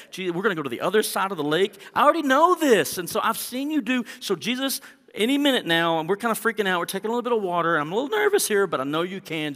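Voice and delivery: loud, high, urgent pleading; frantic prayer. Acted. Believer praying in the storm, loud frantic pleading. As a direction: beg him to wake up now. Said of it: "I think that's okay".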